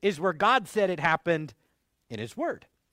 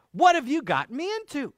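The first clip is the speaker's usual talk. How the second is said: mildly freaked-out, higher-pitched protest; a mild mock scream, raised in pitch; retold freaked-out scream; 6 semitones higher, 3 dB louder than usual. Witch to Saul after seeing Samuel, mildly freaked out. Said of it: "she is freaked out and she screams".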